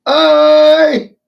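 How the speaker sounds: a very high, loud shout of hey; sudden yell. Pretend. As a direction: bark it out to grab attention.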